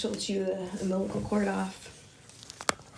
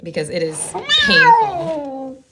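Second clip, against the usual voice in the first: high, slow, loud wail; pained wail; 8 semitones higher, 10 dB louder, slower than usual. Real, not acted. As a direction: wail it out in sympathy. Cat in labour pain.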